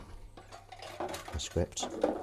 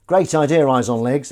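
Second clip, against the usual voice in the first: enthusiastic; 4 semitones higher, 11 dB louder than usual. Real, not acted. Reading chat, enthusiastic.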